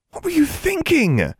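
stern demanding question. A narrator's reading. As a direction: press her for an answer. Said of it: "she demanded".

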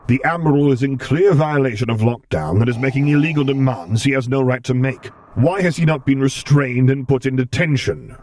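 cold, commanding dark lord; stern commander voice. Acted. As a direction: give orders like an imperial lord. Vader's voice in reading.

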